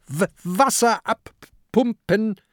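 high stammering order from a little pirate; nervous captain's command; stuttering command. A narrator's reading. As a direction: bark out the order, stumbling on the first word. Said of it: "befiehlt Peter".

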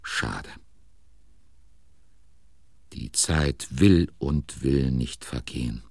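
usual speaking voice

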